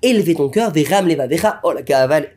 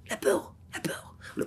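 whispered fear; 8 semitones higher, 15 dB quieter than usual. Shown as a demonstration. Preacher evoking the fear of the soul, whispering. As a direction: breathe the words like a shiver.

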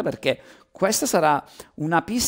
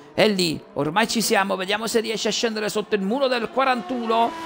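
excited commentary; 7 semitones higher than usual. Real, not acted. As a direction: build to the lap time. Lap time comes in.

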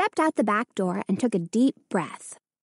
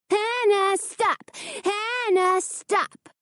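twins parroting "Hannah, stop!" even higher, in a singsong; mock the mother's cry, teasing; mocking imitation; 11 semitones higher, much slower than usual. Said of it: "The twins imitated their mother".

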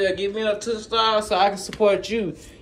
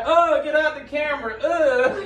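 mock disgusted at camera; 9 semitones higher than usual. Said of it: "I be like".

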